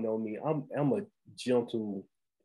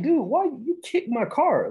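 higher, slower indignant demand, half-acted; imitating his own furious question, slowed and higher; mock angry confrontation; 14 semitones higher, 5 dB louder, slower than usual. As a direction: replay the angry challenge in the parking lot with raised pitch. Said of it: "I was like".

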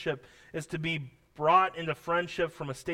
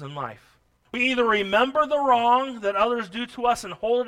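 loud, higher preaching voice; forceful preaching; 5 semitones higher, 7 dB louder than usual. Real, not acted. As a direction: press each point on the congregation.

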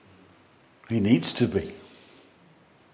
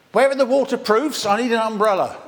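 much higher and louder, fussing for cover; mock panic; 9 semitones higher, 4 dB louder than usual. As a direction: play the flustered churchgoer dodging the rain. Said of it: "So many of us at that point go".

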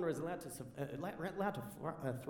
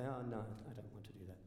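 low, very soft reply to dad; hushed, reluctant no; quiet refusal; 11 semitones lower, 13 dB quieter, faster than usual. Retold or imitated. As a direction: decline meekly, voice dropped right down. Declining dad's hunting invitation again.

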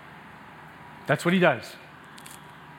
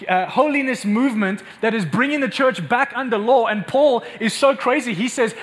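fiery preaching; 5 semitones higher than usual. Acted. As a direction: thunder it from the pulpit. Preaching at full force.

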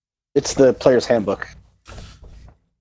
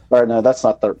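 ordinary talk, nothing marked

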